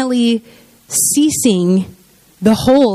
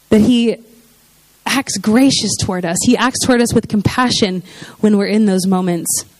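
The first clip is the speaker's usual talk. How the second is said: ordinary talk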